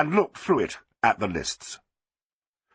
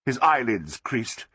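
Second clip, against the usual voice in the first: usual speaking voice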